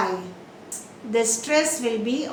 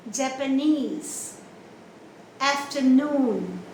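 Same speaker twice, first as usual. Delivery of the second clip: slow Japanese, Afternoon with long NESE and NOON; third syllable stress; slower than usual. Shown as a demonstration. Teacher shows end stress, slow NESE in Japanese, NOON in Afternoon. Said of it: "On the third syllable".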